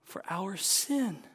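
impassioned sermon climax; preaching at full force. Acted. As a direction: cry it out from the pulpit.

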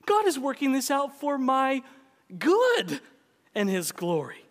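good shouted high and loud; high loud good, plain ending; stress on good. Shown as a demonstration. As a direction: hit the word good high. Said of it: "emphasize different words in that sentence".

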